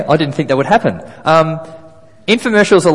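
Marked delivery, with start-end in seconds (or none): none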